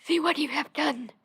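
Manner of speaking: high, loud witch's outcry; screamed. A narrator's reading. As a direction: cry it out accusingly at full voice. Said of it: "she screamed".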